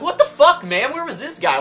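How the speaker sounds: high, loud exclaim; outraged disbelief. Retold or imitated. Customer amazed at a polite clerk, high outraged exclaim. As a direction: blurt it out in disbelief. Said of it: "I'm like".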